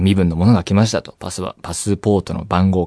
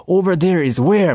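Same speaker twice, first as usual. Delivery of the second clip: imitating a stern officer, higher and slow; mock-harsh questioning of a confused traveller; 7 semitones higher, much slower than usual. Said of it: "すごいきつい口調で言われましてね". (きつい口調の真似)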